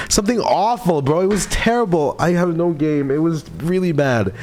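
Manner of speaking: high-pitched embarrassed lament; mortified outburst. Real, not acted. Streamer confessing to chat they have no game, high mortified lament. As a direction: groan over your own blunder, pitched well up. Mortified at own clumsy remark.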